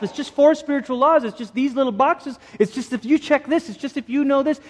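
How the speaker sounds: high, mocking list of easy formulas. Preacher mocks simple formula faith to the congregation, high and mocking. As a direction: sneer at a boxed-in God.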